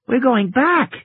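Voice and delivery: put-on high, young girl's voice; child voice. A narrator's reading. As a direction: voice Lex as a small girl. Lex voiced high again.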